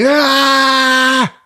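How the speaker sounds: short high, loud yelp; mock shriek. Host yelping at the pinhead's reveal. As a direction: yelp as the monster is unveiled.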